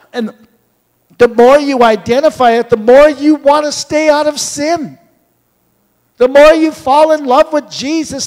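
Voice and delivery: loud, high, fervent sermon climax; preaching at full force. Acted. Preacher urging the church to stay out of sin, loud. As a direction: build and proclaim to the congregation.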